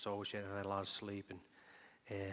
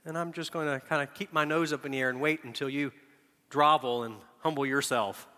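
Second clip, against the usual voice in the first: lofty, louder mock superiority; haughty nose in the air; 4 semitones higher, 8 dB louder than usual. Preacher mocking a proud unforgiving person, haughty. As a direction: play the proud one waiting for an apology.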